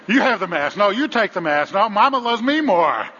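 high, loud mock squabble; squabbling family. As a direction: shout it out like bickering passengers. Squabbling parent and kids.

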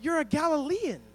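a hint of a young girl calling him out; little girl accusing. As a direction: point the finger like a bold kid. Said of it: "a little girl who says".

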